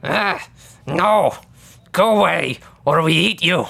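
terse goblin threat, spaced out; lightly growled goblin warning. Pretend. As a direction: warn them off in a goblin voice. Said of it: "The goblin, standing on the left says".

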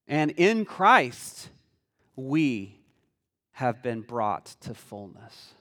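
slow, low, hushed reading; reverent, drawn-out verse reading with pauses; solemn scripture reading. Real, not acted. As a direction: let each phrase sink in, voice dropping. Reads scripture slowly and solemnly.

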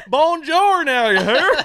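high, hammed-up Southern twang on Bonjour; mock French-Southern. Joking with friends about the movie's mixed accents, mock French-Southern drawl. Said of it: "We speak French and Southern".

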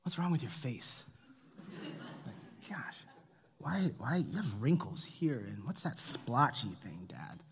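quiet, slow, lightly voiced little daughter; soft curious child. Young daughter inspecting her dad's face, quietly curious. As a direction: ask it gently, like a small girl peering close. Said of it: "and she'll go".

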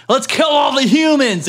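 rally the animals to revolt; mock battle cry. Cow calls for uprising.

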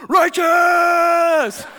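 long, high, full-volume holler; excited yelling. Retold or imitated. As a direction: whoop it out, stretching the word. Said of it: "he's just yelling".

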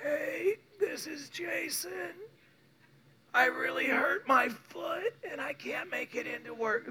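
high, feeble, whimpering put-on sick voice; soft and whiny, hammed-up calling-in-sick act. Employee phoning in sick to work, hammed-up sick voice. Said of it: "this is what you sound like".

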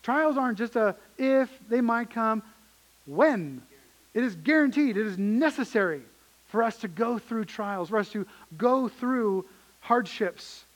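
full-force sermon delivery; preaching at full force. Acted. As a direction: drive it home from the pulpit.

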